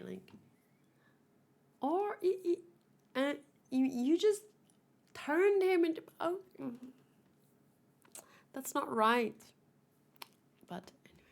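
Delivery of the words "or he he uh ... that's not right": high-pitched sputtering; flustered outburst. Real, not acted. Vlogger outraged at the injustice, high and sputtering. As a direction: sputter high, lost for words.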